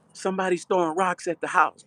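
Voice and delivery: put-on high, alarmed young voice; alarmed kid. Young boy telling his brother about rocks, high and alarmed. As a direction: blurt it out like a startled kid.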